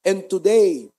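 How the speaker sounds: steady, earnest prayer voice. Acted. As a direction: lead the church in prayer. Closing prayer.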